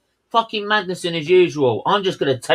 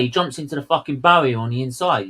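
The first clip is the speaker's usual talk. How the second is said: ordinary talk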